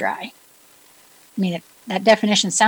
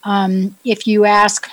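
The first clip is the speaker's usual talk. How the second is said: ordinary talk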